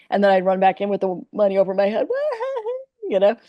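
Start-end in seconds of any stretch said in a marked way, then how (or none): 2.1-3.0 very high-pitched gleeful whoop; high, acted victory laugh; triumphant cackle. Waitress running back in with the money, gleeful cackle.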